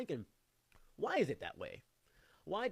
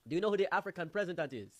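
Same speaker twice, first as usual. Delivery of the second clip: put-on louder, higher African accent; imitated African friend; 6 semitones higher, 5 dB louder than usual. African friend quizzing the group, imitated African accent. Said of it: "Then he said".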